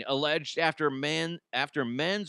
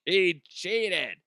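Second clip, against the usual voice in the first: slow, louder hammed-up gasp of shock; mock discovery; 5 dB louder, much slower than usual. Pretend official finding the steel nut in a pocket. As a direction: drag out the fake horror. Acted discovery during pat-down.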